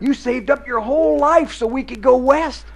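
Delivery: high, distressed plea; pleading. Acted. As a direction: beg a dying friend to hold on. Begging dying friend to live.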